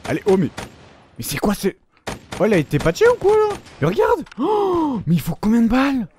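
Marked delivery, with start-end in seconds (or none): 1.2-6.1 much higher, disbelieving cry; can't believe the enemy survives; stunned gamer protest; incredulous outburst. Real, not acted. Enemy surviving many bullets.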